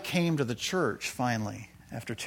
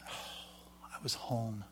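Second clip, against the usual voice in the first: breathless whisper, much softer, barely voiced; hushed whisper; 2 semitones lower, 14 dB quieter, much slower than usual. Real, not acted. Remembering the church where people raised hands, in a moved whisper. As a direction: drop to an awed whisper as it hits you.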